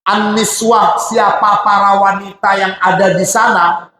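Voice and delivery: normal talking voice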